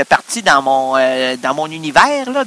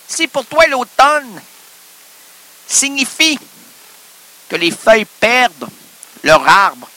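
pompous guru; 6 semitones higher than usual. Acted. Mock guru speech.

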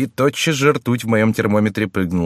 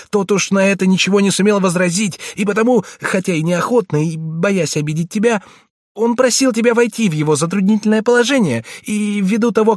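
put-on high, urgent pleading boy voice; frightened page boy; 10 semitones higher, 4 dB louder than usual. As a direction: plead anxiously like a scared young page.

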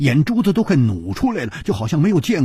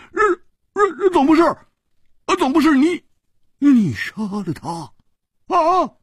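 high-pitched stuttering question; flustered, squeaky stammer; stunned disbelief; 14 semitones higher than usual. Acted. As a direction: sputter it out, hardly daring to ask. Stunned, hesitantly asks about the killing.

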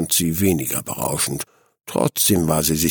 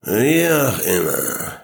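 slow, boozy mumbled reply; drunken stammer; 3 semitones higher, slower than usual. A narrator's reading. Drunk father waving off his wife's rant, stammering. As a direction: drag it out like a fuddled drinker. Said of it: "stammelte ihr Vater".

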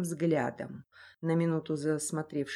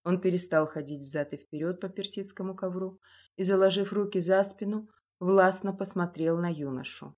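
usual speaking voice

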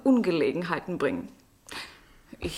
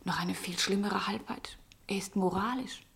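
soft confidential whisper; whispered gossip; 7 dB quieter than usual. Acted. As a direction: lean in and whisper the secret. Confidential gossip about Holk.

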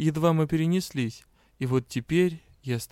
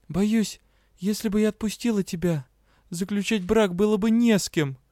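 higher, fast blurt; 7 semitones higher, 3 dB louder than usual. A narrator's reading. Heroine blurts a retort at Rian. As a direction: let it burst out unchecked. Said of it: "выпалила, не сдержавшись".

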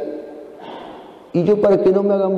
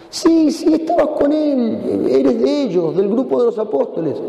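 insisting accuser; 8 semitones higher than usual. Acted.

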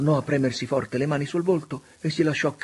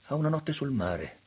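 ordinary delivery, nothing marked

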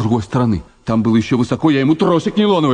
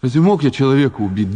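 normal talking voice

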